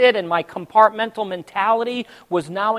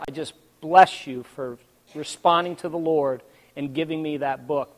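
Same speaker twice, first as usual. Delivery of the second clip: ordinary delivery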